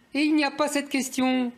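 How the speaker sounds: high, singsong feigned surprise; mock astonishment. Commentator on the question missing from Le Monde, mock astonishment. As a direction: act innocently amazed, pitched high and held. Mocks newspaper's convenient omission.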